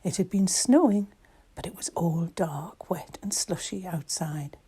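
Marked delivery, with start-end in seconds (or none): none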